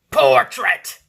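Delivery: "portrait" roared; loud portrait. Shown as a demonstration. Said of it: "Sorry, portrait".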